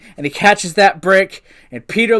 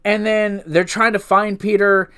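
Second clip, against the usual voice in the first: ordinary talk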